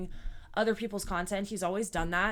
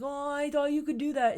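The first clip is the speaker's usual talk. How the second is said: higher, mock whiny man-child; whiny little baby; 6 semitones higher than usual. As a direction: whine it like a sulky kid caught out. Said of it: "a whiny little baby about it".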